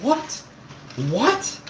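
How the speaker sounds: high, rising one-word disbelief; incredulous. Retold or imitated. Streamer replays his reaction to a wild claim, incredulous.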